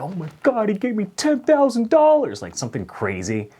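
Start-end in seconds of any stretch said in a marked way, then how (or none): 0.0-2.4 much higher, loud squeal; mock excitement. Waiter dreaming of a huge celebrity tip, squealing. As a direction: gush like you just won the lottery.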